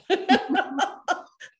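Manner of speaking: real laugh, high and loud; laughing at herself; high burst of laughter. Real, not acted. Laughs at own admission.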